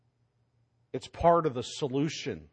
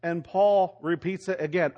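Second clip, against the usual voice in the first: ordinary delivery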